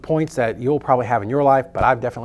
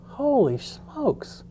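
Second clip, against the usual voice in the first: slow, drawn out and much higher; stunned amazement; 11 semitones higher, 4 dB quieter, much slower than usual. Retold or imitated. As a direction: let the awe stretch the words out. Amazed by girl's earnings.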